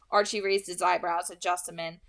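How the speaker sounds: ordinary delivery, nothing marked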